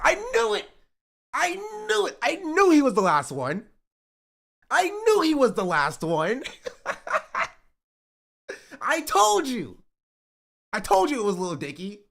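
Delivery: high, excited outburst breaking into laughter; real high-pitched I-told-you-so, laughing; gleeful vindication. Real, not acted. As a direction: crow that you called it, voice leaping up. Vindicated guess about the last one.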